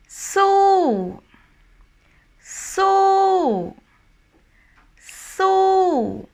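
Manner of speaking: "so" held high, then falling away. Shown as a demonstration. Teacher giving the last example word, falling tone "so". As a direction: sustain "so" up high, then slide down. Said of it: "my voice is kind of like".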